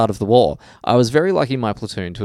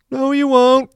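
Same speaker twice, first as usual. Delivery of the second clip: high-pitched, loud mock-villain yell; beer baron voice; 6 dB louder, slower than usual. Young soldier yells back at the sergeant, beer baron voice. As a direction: call it out as a defiant cartoon baron. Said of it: "in my best beer baron voice".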